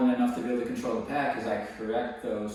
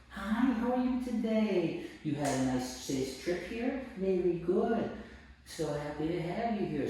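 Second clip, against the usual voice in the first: sweet dog greeting; 7 semitones higher, 4 dB quieter than usual. Real, not acted.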